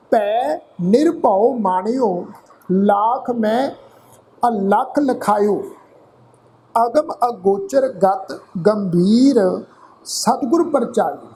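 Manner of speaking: slow, careful gurbani recitation, syllable by syllable. Shown as a demonstration. Teacher reciting scripture to learners, slow and deliberate. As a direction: recite each word slowly for the students. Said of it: "ਪਾਠ ਨਾਸਕੀ ਉਚਾਰਨ ਕਰਾਂਗੇ ਜੀ".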